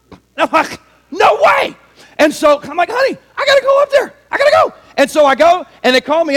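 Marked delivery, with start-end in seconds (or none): 1.1-1.8 high, excited shout; excited disbelief. Acted. Dreamer reacting to the NCAA letter, excited shout. 2.9-4.7 high, rushed, excited yell; urgent excitement. Acted. Husband telling honey he must go, urgent excitement. Dream, urgent plea to wife.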